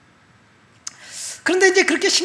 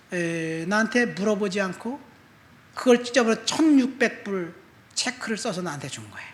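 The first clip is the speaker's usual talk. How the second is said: normal talking voice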